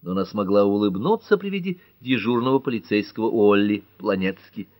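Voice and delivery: ordinary delivery, nothing marked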